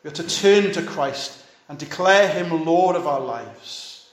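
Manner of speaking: usual speaking voice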